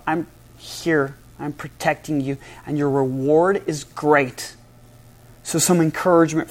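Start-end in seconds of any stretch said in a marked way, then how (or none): 0.1-4.5 much softer, tender reassurance; gentle and loving. Acted. God gently reassuring Abram, soft and tender. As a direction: speak it softly like a loving father, slowing at the end. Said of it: "he speaks in a very gentle and loving way".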